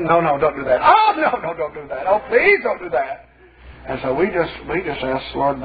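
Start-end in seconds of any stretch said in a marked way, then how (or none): 0.0-3.3 imitated demon screaming. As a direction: wail like a demon begging for mercy. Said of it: "that thing started screaming".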